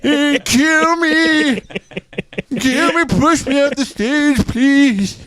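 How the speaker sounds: put-on high, very slow, pleading old man; hammed-up frail old man voice; feeble old Kirk Douglas. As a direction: beg weakly like a worn-out old star. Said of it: "And he's like".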